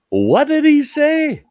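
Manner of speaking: high, slow, baffled mock question; mimicking a crowd that can't follow an accent; confused listeners. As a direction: pitch way up, drag it out, lost. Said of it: "everybody's going".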